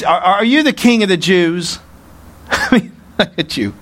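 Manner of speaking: mocking disbelief with a scoffing laugh; sarcastic. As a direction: ask it as if the claim were laughable. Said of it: "sarcastically. You can see the inflection".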